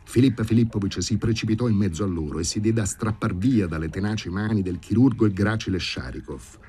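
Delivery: plain reading voice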